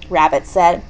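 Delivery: ordinary delivery, nothing marked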